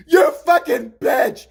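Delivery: loud, high, drawn-out yell; furious shout. Retold or imitated. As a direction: imitate an enraged friend yelling from upstairs. Friend's yell heard from stairs.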